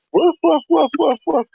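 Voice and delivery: high playful woofs; mock barking. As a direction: bark back at the dogs. Barking at dogs.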